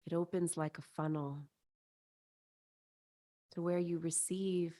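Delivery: soothing guided-visualization voice, spacious phrasing; guided meditation. Acted. Leads a chakra meditation.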